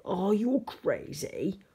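loud, indignant monster voice; furious monster. A narrator's reading. Furious monster corrects George about her name, loud and outraged. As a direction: roar it in outrage. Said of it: "with a furious gaze".

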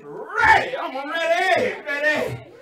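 high, loud, eager yelling; hyped up and can't wait; shouting he's ready; excited shout. Retold or imitated. Eager before sex scene.

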